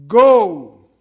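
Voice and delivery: loud, forceful command; the Lord's loud command to the healed blind man. Acted. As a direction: bark the single word out. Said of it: "emphasis in the first word".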